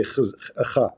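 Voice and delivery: khiz and kha with a rasping throat kh; Hebrew throaty kh. Shown as a demonstration. Comparing Hebrew to Arabic, a throaty kh. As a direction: scrape the kh deep in the throat. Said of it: "They don't say hey. They say".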